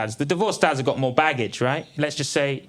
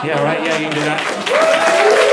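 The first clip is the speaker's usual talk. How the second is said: mock cheer; 2 semitones higher, 8 dB louder, slower than usual. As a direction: crow it at the volunteer, drawn out. Teasing a volunteer from the audience.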